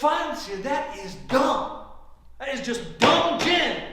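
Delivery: high, leaning hard on "dumb"; scornful exasperation. Acted. Loser criticizing her gin play, scornful. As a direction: scold the opponent's play, punching "dumb".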